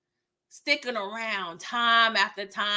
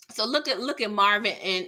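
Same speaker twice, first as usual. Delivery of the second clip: usual speaking voice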